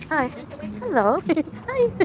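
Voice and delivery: high, loud cooing at a dog. Real, not acted. Greeting a cute dog on the street, high cooing baby talk.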